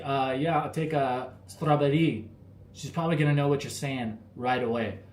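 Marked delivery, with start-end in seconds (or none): 0.0-2.3 slow English in a Thai accent. Shown as a demonstration. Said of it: "I say it with the Thai accent".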